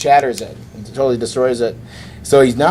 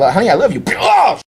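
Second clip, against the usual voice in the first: mock lover shout; 8 semitones higher than usual.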